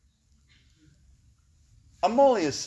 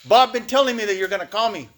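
loud, raised voice of a tough guy; Tomas impression; 5 semitones higher, 5 dB louder than usual. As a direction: cut in loudly, imitating a blunt sponsor. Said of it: "That's how he talks".

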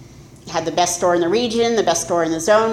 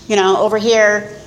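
normal talking voice

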